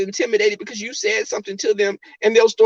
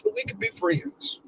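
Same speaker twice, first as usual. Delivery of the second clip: high soft mock offer; 10 dB quieter than usual.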